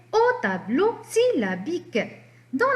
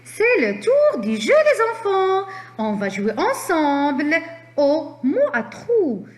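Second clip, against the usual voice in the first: higher and louder, like a game host; excited announcement; 5 semitones higher, 4 dB louder than usual. Real, not acted. Teacher hyping the children for the word game, excited.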